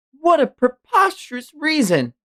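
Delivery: high, scornful royal outburst; a high voiced affronted king; indignant king. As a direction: scoff at the knight's request. Voicing the king.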